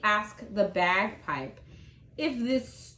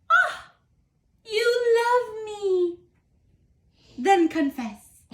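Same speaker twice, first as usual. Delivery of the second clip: high, thrilled cry of delight; overjoyed turtle; 10 semitones higher, 4 dB louder than usual. Acted. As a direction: burst out with joy, pitched up. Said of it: "Said the turtle to the bagpipe".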